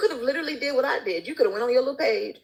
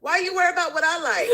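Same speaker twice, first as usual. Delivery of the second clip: laughing retort; 8 semitones higher than usual. Retold or imitated.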